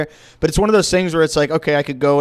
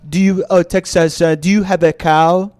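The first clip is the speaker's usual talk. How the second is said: mock French student's accented English, slightly raised and louder; 3 semitones higher, 4 dB louder than usual. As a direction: puzzle it out in a thick French accent. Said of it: "the guy, like, switched to English".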